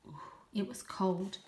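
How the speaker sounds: ordinary delivery, nothing marked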